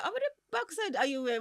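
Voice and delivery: high-pitched imitation of baffled relatives; mock disbelief. Family reacting to her clothes, in high mock disbelief. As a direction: exclaim it high, in mock outrage.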